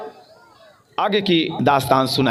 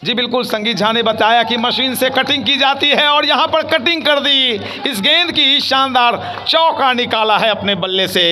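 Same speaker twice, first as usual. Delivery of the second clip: loud, high commentary on a boundary; excited cricket commentary; 9 semitones higher, 4 dB louder than usual. Real, not acted. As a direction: call the four with a raised voice. Boundary hit, commentator shouts.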